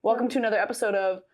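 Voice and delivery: usual speaking voice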